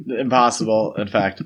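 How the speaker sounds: ordinary delivery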